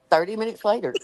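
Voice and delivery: ordinary talk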